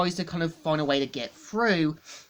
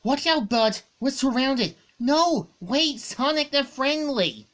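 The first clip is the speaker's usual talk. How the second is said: higher, lightly acted urgent warning; voicing the two heroes; 8 semitones higher, 4 dB louder than usual. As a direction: warn of danger, then reassure. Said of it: "we see Tails saying".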